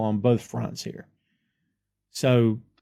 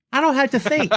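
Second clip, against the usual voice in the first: smug; 11 semitones higher than usual. Real, not acted. Joking boast about notes.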